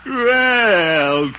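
indignant surprise. Acted. Caught being teased.

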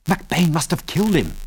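usual speaking voice

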